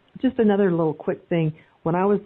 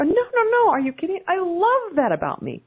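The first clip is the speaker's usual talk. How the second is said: bright high reply; 12 semitones higher than usual. Retold or imitated. Answering the man who shushed her, high and bright. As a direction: sing it out, amused and proud. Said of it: "I go".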